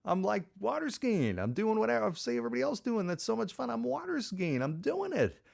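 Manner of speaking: high, giddy reenacted excitement; elated triumph. Skier finally up and doing it, gleefully triumphant. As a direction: relive the thrill in a high-pitched voice. Reliving first success on skis.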